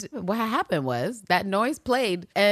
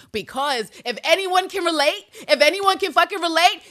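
rising, louder, more insistent; building emphasis; 10 semitones higher, 6 dB louder than usual. Real, not acted. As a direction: push it higher each time.

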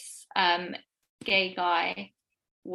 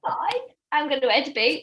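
mock leaving; 8 semitones higher, 5 dB louder than usual. Joking exit.

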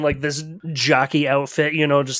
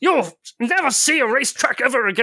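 sputtering foiled villain; 6 semitones higher than usual. Acted. Jockey storms off threatening.

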